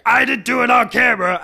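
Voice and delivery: high, loud bubbly protest; bubble voice. Retold or imitated. Co-host imitates Vicky's high bubble-voice denial, loud squeal. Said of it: "in her bubble voice".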